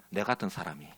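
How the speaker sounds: usual speaking voice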